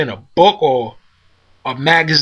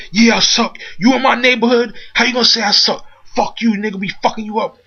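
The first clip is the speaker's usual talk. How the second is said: imitating a furious thug, higher; mock angry attacker; 7 semitones higher than usual. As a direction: fire it off like the guy stomping him. Attacker yelling during the beating.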